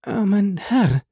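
wife murmuring in fear; fearful murmur. A narrator's reading. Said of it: "murmelte sie".

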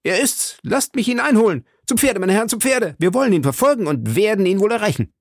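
high, urgent, enraged cries; rally them hotly to the chase; D'Artagnan, fierce. A narrator's reading. D'Artagnan urges his friends to horse after the stranger, fiercely. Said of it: "rief D'Artagnan blass vor Ingrimm".

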